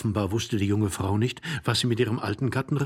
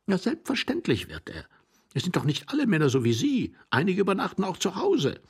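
lightly voiced higher young man; sardonic young man; 10 semitones higher than usual. A narrator's reading. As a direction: jibe at the older man, pitched up. Young man mocks older man.

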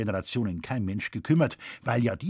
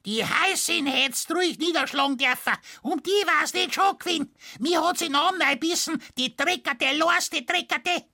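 very high, piercing tirade; furious peasant woman boiling over; shrill screaming farmer's wife; 3 dB louder than usual. A narrator's reading. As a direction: screech abuse in Bavarian falsetto. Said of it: "Mit schriller Stimme schrie sie".

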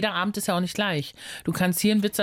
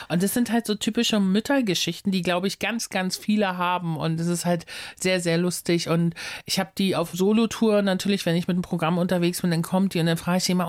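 ordinary talk, nothing marked

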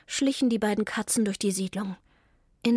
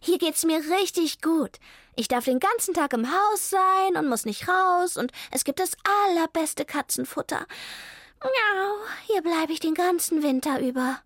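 higher-pitched, delighted cat; contented cat Millie; 7 semitones higher than usual. A narrator's reading. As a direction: revel in the good life, pitched up. Millie's figure voice. (zufriedene Katze mit hoher Stimme)

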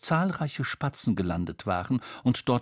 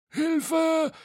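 high-pitched yelp for help, little added volume; squeaky cry for help; panicked cry. A narrator's reading. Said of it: "schrie Bo".

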